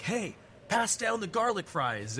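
mild imitation of a guy asking for the fries; stranger asking for fries. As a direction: mimic a casual stranger demanding a share. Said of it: "he's like".

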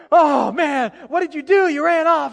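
loud, high frustrated scolding; acted angry outburst at a child; imitating mad dad. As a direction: yell it like an exasperated father. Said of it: "he's all mad, and he's frustrated".

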